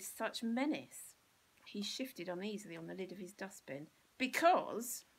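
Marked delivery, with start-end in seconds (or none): 4.2-5.0 loud, higher, savage bark. Acted. Bill turns on the bully, savage and loud. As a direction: hurl it at him. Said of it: "continued Bill savagely".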